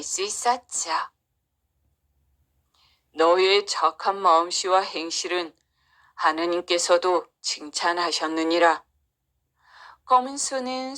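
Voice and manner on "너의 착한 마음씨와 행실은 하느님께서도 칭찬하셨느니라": low, stately magical cow; lowered voice of a wise cow. Acted. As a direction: bestow praise as a solemn animal helper.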